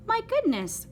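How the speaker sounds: high surprised cry of delight. A narrator's reading. Adeline spotting the toad at her door, high surprised cry. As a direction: gasp it out, thrilled at the visitor. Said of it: "cried Adeline".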